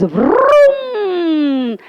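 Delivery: long, high engine vroom falling away; auto starting off. Retold or imitated. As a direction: imitate a rickshaw pulling off. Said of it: "and off she goes".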